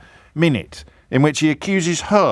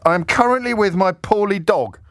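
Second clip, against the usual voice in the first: mock self-pity; 7 semitones higher than usual. Host reads punchline line.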